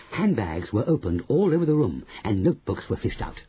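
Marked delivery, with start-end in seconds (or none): none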